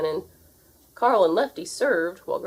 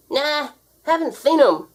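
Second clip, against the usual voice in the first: put-on slow, higher, lightly gummy old man; toothless old man; 9 semitones higher, 6 dB louder than usual. A narrator's reading. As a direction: mumble it cheerfully without teeth. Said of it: "he smiled".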